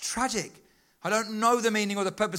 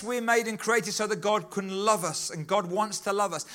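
usual speaking voice

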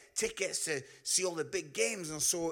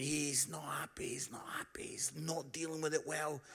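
whisper; 6 semitones lower, 9 dB quieter than usual. Retold or imitated. Aside about upset friend.

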